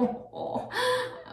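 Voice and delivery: high, airless giggle; a quick, voiceless laugh at herself; breathy laugh. Real, not acted. Vlogger to viewers about joining TikTok, with a breathy laugh.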